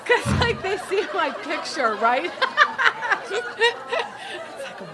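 talking through big bursts of high laughter; words breaking up as you laugh hard; real laughter. Real, not acted. Laughing hard at confessional story.

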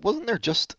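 ordinary talk

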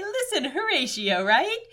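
high, drawn-out promo voice; like a radio host pitching a fund drive; Clint McElroy. Acted. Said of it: "Clint McElroy promoting the Max Fun Drive".

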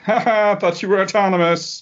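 high-pitched mock gloating; mocking taunt. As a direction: jeer in a put-on high voice. Mocking imagined conservative retweets.

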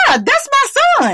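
a mother defending her son, shouting; fierce shout. Real, not acted.

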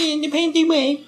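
very high, wimpy mimicry; mock-dainty high voice; mocking whiny voice. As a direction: tease in a high, whiny squeal. Mocking the other's dainty manner.

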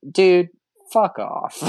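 high, loud mock outburst; mock annoyed yell. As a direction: snap it out in mock anger. Retold annoyed reply.

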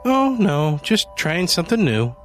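bright cheerful. A narrator's reading. Cheerful Janie at the store.

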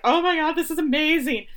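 retold squeal of happy amazement; thrilled. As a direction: gush with delight, imitating her joy. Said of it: "so frickin' happy".

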